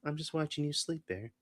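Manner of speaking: soft, high, creepy little voice; creepy hushed imitation; imitating creepy Nikki. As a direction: murmur it softly and high, imitating her. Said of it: "And then I just hear".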